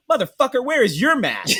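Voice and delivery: loud, high, half-laughing comeback; retold loud retort. To the maskless hotel clerk, a loud laughing comeback. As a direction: throw it back at him, laughing in disbelief.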